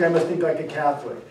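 ordinary talk

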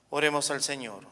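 usual speaking voice

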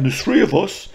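high-pitched retold boast; mimicked lads' warning. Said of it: "like that, they said that".

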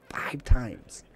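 breathy emphatic whisper of the number. Acted. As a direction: whisper it for dramatic effect.

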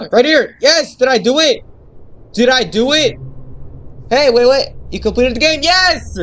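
gasping high loud joy; excited. Real, not acted. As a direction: burst out with winning glee. Finding the exit, winning game.

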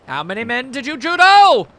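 building to a high, loud cry; hammed-up shout. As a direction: let it swell into a big finish. Shouted joke line, rising excitement.

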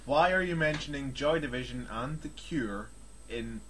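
ordinary delivery

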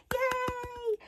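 high squealed yay; a delighted little squeal of a cheer; excited cheer. Real, not acted. Crafter cheering her Halloween challenge, high squealed yay.

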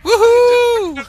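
high gleeful whoop; cheering. Real, not acted. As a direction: whoop in triumph.

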